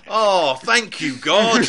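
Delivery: slow, loud, laughing groan of despair; mock dismay. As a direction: ham up the sinking heart, laughing. Mock dismay at Pink Panther.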